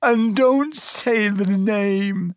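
high, slow tearful plea; sobbing. A narrator's reading. Hagrid begging Harry not to name Voldemort, sobbing. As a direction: beg through drawn-out sobs. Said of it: "sobbed Hagrid".

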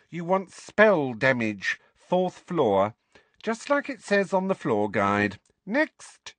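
curt receptionist witch, slow and pointed; irritable witch. A narrator's reading. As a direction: spell it out impatiently.

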